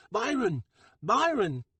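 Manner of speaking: high, loud urgent call. Acted. Jet calling Bronco from the hallway, loud and urgent. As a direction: shout his name down the hall.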